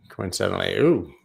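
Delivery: ordinary talk, nothing marked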